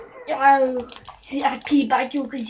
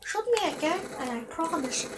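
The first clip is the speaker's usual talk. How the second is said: usual speaking voice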